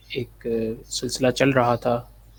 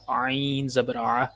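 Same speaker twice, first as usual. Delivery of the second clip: slow, drawn-out letter-by-letter drill; measured Qaida teacher's recitation; spelling drill recitation; much slower than usual. Shown as a demonstration. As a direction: intone each letter, then its syllable. Drills spelling out Quranic syllables.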